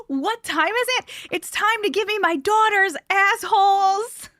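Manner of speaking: high, loud retold scream. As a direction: scream it in her face, high and furious. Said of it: "I started screaming as loud as I could".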